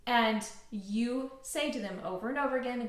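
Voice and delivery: usual speaking voice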